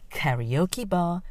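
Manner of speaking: plain reading voice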